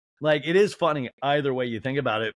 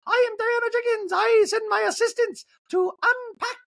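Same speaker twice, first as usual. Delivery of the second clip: Diana Jenkins; 4 dB louder than usual. Retold or imitated. Housewife boasting about assistants unpacking, high haughty caricature. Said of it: "My Diana Jenkins sounds ridiculously close".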